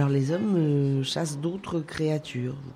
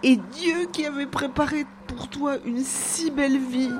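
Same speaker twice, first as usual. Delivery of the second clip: put-on grieving child's lament; 8 semitones higher than usual. A narrator's reading. Child grieving over dead hare.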